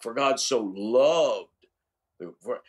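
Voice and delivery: LOVED drawn out long and strong; stress on LOVED. Shown as a demonstration. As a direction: lean slowly into the word loved. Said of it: "putting an inflection on a different word".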